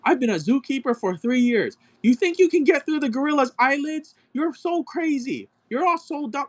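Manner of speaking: high whiny mock voice of a know-it-all; ridiculing an arrogant zookeeper in a high whine. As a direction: squeak it out like a smug zookeeper. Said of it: "He's like".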